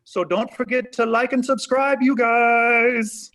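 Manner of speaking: loud, high-pitched YouTuber sign-off; Jesus. Acted. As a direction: hype up the subscribers, stretching into a high finish. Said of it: "try to get famous on YouTube".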